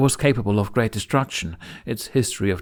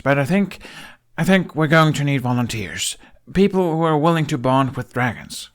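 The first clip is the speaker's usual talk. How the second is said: higher and louder, earnest and flustered; nervous young man making his case, raised voice; urgent Hiccup; 5 semitones higher, 4 dB louder than usual. A narrator's reading. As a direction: talk fast through a hard pitch to the elders. Hiccup proposing volunteers.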